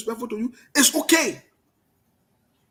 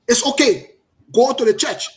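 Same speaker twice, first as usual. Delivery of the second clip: loud and defiant; 6 dB louder than usual. Real, not acted. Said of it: "I talk the way I talk it".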